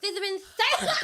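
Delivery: mock a lisp on THITHER through laughter. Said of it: "Just do an impression of me".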